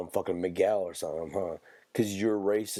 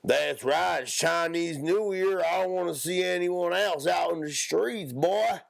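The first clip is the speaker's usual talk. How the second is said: exaggerated hillbilly accent, pitched up; 8 semitones higher than usual. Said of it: "and then there's a hick".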